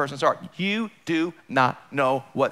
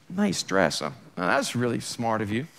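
snide sarcasm; 6 semitones lower, 5 dB quieter than usual. Retold or imitated. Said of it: "the way you said it, you had a lot of attitude".

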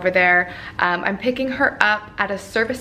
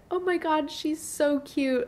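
pitched-up mock gush; imitating friend's gushing; 6 semitones higher than usual. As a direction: mimic a friend melting over a puppy. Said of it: "the friend of mine looked in the van and said".

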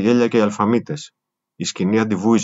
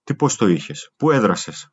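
ordinary delivery

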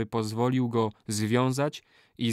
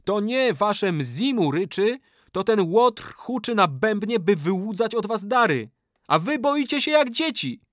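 booming voice; 9 semitones higher than usual. Acted. Boy exposing the sorcerer to warriors, booming voice. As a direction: declaim it to shame the crowd. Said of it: "rzekł donośnym głosem".

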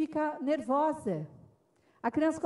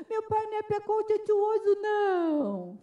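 high, babyish mock-tearful protest; imitated crying child; 8 semitones higher than usual. Boy answering the teacher's accusation, imitated wailing. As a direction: imitate a little boy crying through his words. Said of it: "o menininho disse chorando".

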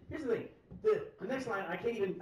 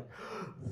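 sharp, quiet intake of breath; mock-shocked gasp; awed gasp; 5 semitones higher, 8 dB quieter than usual. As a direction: re-enact a stunned gasp. Retold awe at fighting stance.

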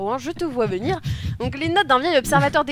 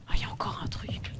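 whisper; 12 dB quieter, faster than usual. Real, not acted. Whispered aside.

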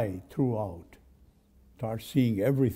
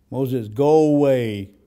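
slow, loud stern dismissal; angry God; 8 dB louder, slower than usual. Retold or imitated. God rebuking Moses, a loud slow mock-stern voice. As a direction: push Moses away with loud slow anger. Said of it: "God was very angry".

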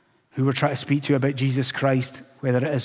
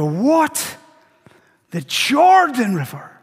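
incredulous outrage, high and loud; indignant Naaman; 8 semitones higher, 5 dB louder than usual. Acted. Naaman told to wash in the Jordan, furious refusal. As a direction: protest like a proud man insulted, leaning hard on key words. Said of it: "we could maybe even hear Naaman".

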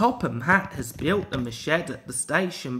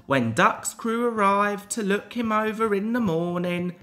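ordinary delivery, nothing marked

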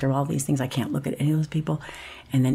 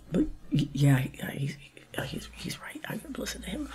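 mocking little whisper of agreement; meek whisper; 4 semitones higher, 9 dB quieter than usual. She mockingly agrees with the prosecutor in a tiny whisper. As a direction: shrink away and murmur it.

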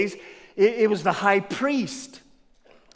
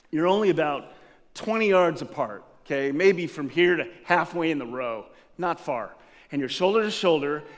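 ordinary talk

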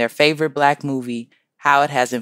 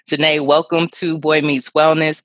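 usual speaking voice

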